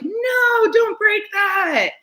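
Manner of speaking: high-pitched, drawn-out mock scream; panicked parent shout. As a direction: cry out to stop a child in alarm. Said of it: "and you're like".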